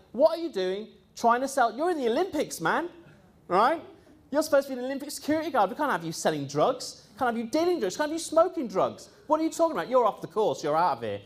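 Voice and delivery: high, building mock rant at the dealer; pretend telling-off. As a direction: scold him as the trainer should have, rising each line. Imagined rant trainer should give.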